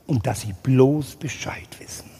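hushed warning, barely voiced; whispered threat. Simon warns the teacher, whispering menacingly.

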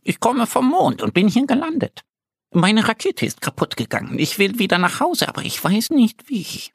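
high little voice, a touch sad and hurried; sad little moon man. Acted. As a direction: tell it like a small lost stranger. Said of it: "sagt es traurig".

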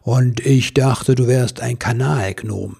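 big, deliberate, amused delivery; grinning Santa. Acted. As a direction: tease with a broad grin in the voice. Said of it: "Der Weihnachtsmann grinste".

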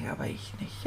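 breathless whisper, almost no voice; whispered reply. Retold or imitated. Boy whispers back sulkily to his friend Paul, whispered. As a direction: mutter it under your breath as a whisper.

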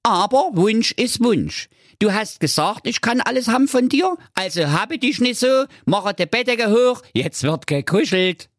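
cheeky high frog voice; insistent frog. Acted. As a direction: hold her to the promise.

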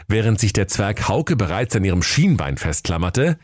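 usual speaking voice

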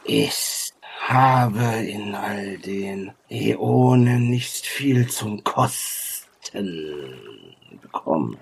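slow, drawn-out words, sinking at the end; ancient creature sage. Acted. Ancient being talks of tasting in the aeons, slow and weary. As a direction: linger on each word like an age-old being. Game master voices the sage.

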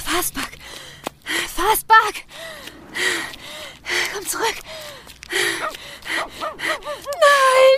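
high anguished shout; desperate cry. Acted. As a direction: scream no as the dog runs off. Hund läuft auf die Straße. (verzweifelter Schrei)

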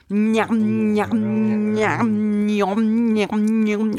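loose chewing njam njam; chewing sounds. Shown as a demonstration. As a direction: chew loosely while saying njam.

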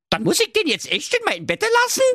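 very high, rushed disbelief; fast, high-pitched whine; daughter. Acted. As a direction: blurt the question as an appalled daughter. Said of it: "fragte die Tochter".

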